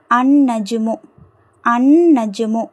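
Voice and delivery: long held N joining "அன் நஜ்மு", no L; silent lam. Shown as a demonstration. Teacher shows lam is absent, held N in "அன் நஜ்மு". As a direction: go straight from A into a doubled N in "அன் நஜ்மு". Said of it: "லாம் இல்லை".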